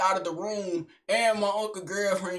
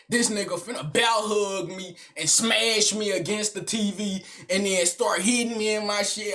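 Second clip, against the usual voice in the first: ordinary delivery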